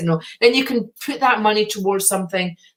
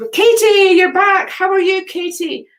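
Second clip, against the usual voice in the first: high, loud delighted hello to Katie; light up as she spots her friend back; excited greeting; 10 semitones higher, 4 dB louder than usual. Real, not acted. Greeting returning viewer Katie.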